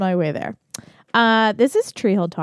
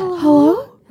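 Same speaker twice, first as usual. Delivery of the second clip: horror movie hello; 7 semitones higher, 5 dB louder than usual. Retold or imitated. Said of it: "in the horror movies when someone".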